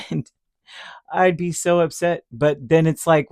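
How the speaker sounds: ordinary talk, nothing marked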